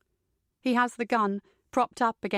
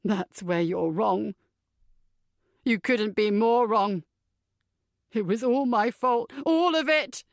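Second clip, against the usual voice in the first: bitter. A narrator's reading. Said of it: "Tom gives a humorless laugh".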